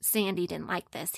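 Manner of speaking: usual speaking voice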